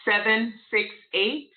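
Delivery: numbers spaced out carefully. Real, not acted. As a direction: say each digit slowly and clearly. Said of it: "say that one more time".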